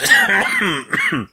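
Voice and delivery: harsh chesty cough; sick cough. Real, not acted. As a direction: cough hard mid-sentence. Sick man coughs mid-sentence.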